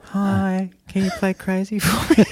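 high put-on woman's voice; dreamy woman. Host mimicking the woman caller in the video, high. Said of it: "dreamy looking woman".

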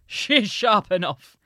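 slow, loud storybook father; father's voice. A narrator's reading. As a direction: draw it out loudly as the father. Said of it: "said the father".

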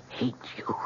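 breathy hushed whisper. Retold or imitated. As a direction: hiss it under the breath. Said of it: "but I said it again".

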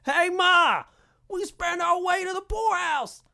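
very high, loud yokel yell calling to Ma; shouting hillbilly. Shown as a demonstration. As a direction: holler it across the yard at Ma. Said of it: "Less, less".

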